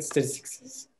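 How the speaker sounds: "statistics is" soft and slurred into one word; "statistics is" with no stress, endings merged; unemphasized plural. Shown as a demonstration. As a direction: run "statistics" straight into "is", softly. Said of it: "I didn't emphasize on statistics".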